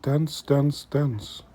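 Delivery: ordinary talk, nothing marked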